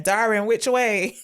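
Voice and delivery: mild retold shout, stretched out; mock scream. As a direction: call out across the rocks in play. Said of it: "is screaming".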